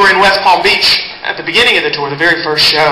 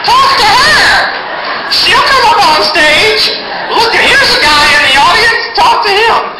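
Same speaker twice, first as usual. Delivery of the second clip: much higher, slow shouting busybody; loudmouth audience member; slower than usual. Retold or imitated. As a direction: yell like the pushy friend pointing everyone out. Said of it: "there's always some big mouth sitting next to 'em".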